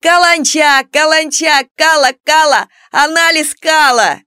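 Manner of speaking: high, loud schoolyard taunt; yelled jeering. A narrator's reading. Class troublemaker mocks Аннушка at the blackboard, yelled taunt. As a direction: holler it like a class bully chanting insults. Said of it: "завопил в голос".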